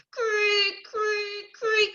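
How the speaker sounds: high drawn creak; spooky creak sound; creaking rope. A narrator's reading. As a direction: make the old rope creak.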